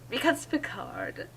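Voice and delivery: ordinary delivery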